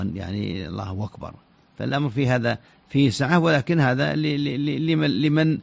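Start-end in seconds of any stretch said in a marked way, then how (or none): 0.7-1.3 الله أكبر said as "Allahu wakbar"; waw in place of hamza. Shown as a demonstration. Scholar illustrating a dialect substitution, hamza turned to waw. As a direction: turn the start of أكبر into a w after الله. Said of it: "إبدال الهمزة، آه، واوا".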